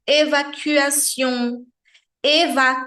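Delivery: evacuation devagar, final nasal sem ação; pronúncia francesa. Shown as a demonstration. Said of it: "a repetição leva à perfeição". (evacuation bem francês)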